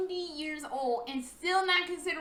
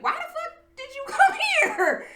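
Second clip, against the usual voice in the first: high, loud incredulous laugh; baffled laugh; 13 semitones higher, 7 dB louder than usual. Retold or imitated. Mimicking Danny baffled she left Florida, laughing and loud. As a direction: laugh in disbelief at the choice.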